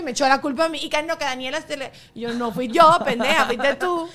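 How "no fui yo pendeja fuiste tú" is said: laughing retold outburst, a bit louder; half-laughing mock anger; mock angry. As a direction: fake fury through laughter. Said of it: "así de arrecha".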